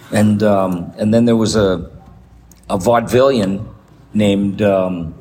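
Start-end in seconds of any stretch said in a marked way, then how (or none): none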